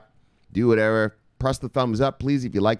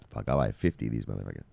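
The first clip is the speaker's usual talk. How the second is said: muttered aside; 11 semitones lower, 7 dB quieter, faster than usual. Real, not acted. Excited aside about cheap coins.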